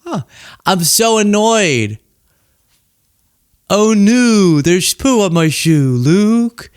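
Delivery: a hammed-up little moan; mock annoyed whine. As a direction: play up petty annoyance, half joking. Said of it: "you're like".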